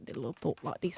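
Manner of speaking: low, quiet mock New Zealand accent; imitated New Zealand accent. Shown as a demonstration. Interviewer showing guest how New Zealanders talk, imitated accent. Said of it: "New Zealand talk like".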